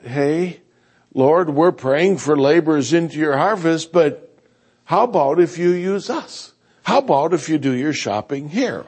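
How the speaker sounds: normal talking voice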